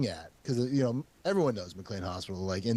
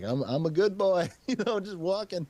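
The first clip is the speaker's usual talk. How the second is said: put-on a touch higher, sweet good-boy voice; mock innocent plea; 8 semitones higher than usual. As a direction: sell yourself as harmless. Pleading innocence to patrolman.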